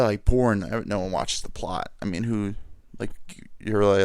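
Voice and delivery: ordinary delivery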